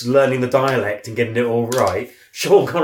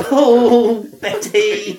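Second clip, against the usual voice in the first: high, loud hammed-up Frank Spencer whine; 8 semitones higher, 4 dB louder, much slower than usual. Mate doing Frank Spencer for laughs, high whine. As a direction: camp it up like the sitcom bumbler.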